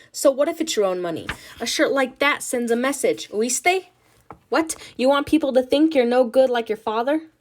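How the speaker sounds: put-on loud, angry Spanish-accented scolding; berating mother. Ma berating Nina as lazy, loud and angry. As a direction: shame her, building heat.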